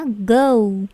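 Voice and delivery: "Go" gliding to "gou"; English diphthong O. Shown as a demonstration. Teacher correcting students' Go, gliding vowel. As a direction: draw "Go" out into a U. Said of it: "orang Inggris mereka tidak bilang O".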